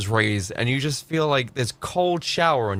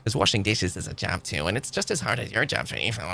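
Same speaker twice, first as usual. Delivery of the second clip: mock girlfriend; 7 semitones lower, 5 dB quieter than usual. Mocking a girlfriend's lecture.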